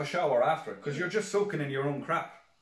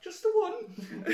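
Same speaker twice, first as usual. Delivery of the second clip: high, slow squeak through laughter; drawn-out, giggling repeat; laughing punchline repeat; much slower than usual. Real, not acted. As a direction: wheeze the punchline out while cracking up. Repeating own punchline, cracking up.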